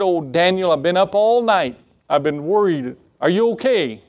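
worried king. Acted. King checks on Daniel after a sleepless night, mildly worried. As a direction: call down with mock concern.

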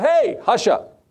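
high, drawn-out hushing; acted rebuke. Crowd hushing the blind beggar, mock rebuke. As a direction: scold him into silence, playfully acted. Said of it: "they began to rebuke him".

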